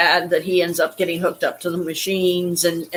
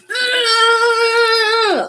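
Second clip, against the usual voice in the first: a very high, loud, drawn-out wordless yell of frustration; frustrated scream; 3 dB louder than usual. Retold or imitated. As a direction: wail it like a man furious he can't speak. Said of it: "he was like".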